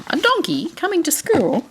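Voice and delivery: a donkey at school, delighted surprise; surprised question. Real, not acted. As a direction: sound amazed for the little ones. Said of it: "reader asks child, surprised".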